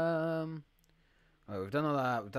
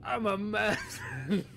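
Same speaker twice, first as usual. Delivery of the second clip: higher, slow claim breaking into laughter; laughing mock protest; 8 semitones higher, 5 dB louder, slower than usual. Streamer claiming toughness to chat, laughing mock protest. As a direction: insist playfully, then crack up.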